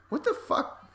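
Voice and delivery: high, breathy squeak of disbelief; mock shocked what the fuck; incredulous. Reacting to Spanish torture.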